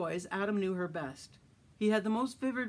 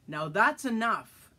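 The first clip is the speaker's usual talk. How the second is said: slow, louder stern warning; stern demand; 3 semitones higher, 6 dB louder, slower than usual. A narrator's reading. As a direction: shut it down, slowly. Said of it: "Adam required".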